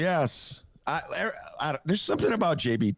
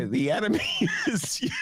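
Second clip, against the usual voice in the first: Pritzker impression; 8 semitones higher than usual. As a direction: quote the governor through a laugh. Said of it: "when he does that, like".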